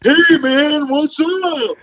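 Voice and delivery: very high, put-on buddy voice; squeaky mock greeting from a friend; friend's texting voice. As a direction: imitate a pal in a silly high voice. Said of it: "like, your friend, it's like".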